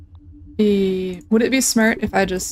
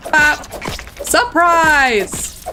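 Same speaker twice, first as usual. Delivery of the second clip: playful shout; 6 semitones higher, much slower than usual. Pretend. Ambushing an enemy.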